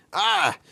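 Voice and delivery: sharp high scream. A narrator's reading. The kid screams as the jaws snap shut. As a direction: shriek in terror. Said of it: "you scream".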